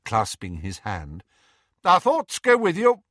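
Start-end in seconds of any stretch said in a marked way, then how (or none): 1.8-3.0 high, loud, emphatic good wishes; earnest witch. A narrator's reading. Hestia sending Harry off with good wishes, high and earnest. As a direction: offer it fervently in a high voice.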